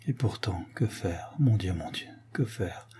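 almost to herself, helpless; hushed anguished wondering; despairing to herself. A narrator's reading. Desperate girl talks to herself.

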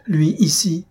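gasping out the words in shock; startled cry. A narrator's reading. A young woman startled by her lover at her door, crying out. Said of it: "s'écria-t-elle".

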